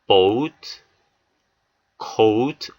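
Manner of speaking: "boat, coat" with a long oh-oo glide; long O sound. Shown as a demonstration. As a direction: draw out the vowel in "boat, coat" as 오우. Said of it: "오우 소리 들리시죠". ("boat, coat" 모음을 '오우'로 길게)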